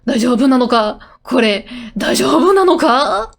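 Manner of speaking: loud, panicked questioning in Japanese; acted full-on panic; freaking out. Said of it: "She's full on freaking out".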